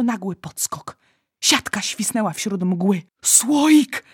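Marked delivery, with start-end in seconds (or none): 3.2-4.0 high, loud one-word shout; urgent call. A narrator's reading. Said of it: "zawołał olbrzym".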